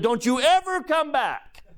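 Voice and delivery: high, laughing mock threat; mock stern dad to monster. Dad to the monster, mock stern and laughing. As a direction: playfully banish the closet monster.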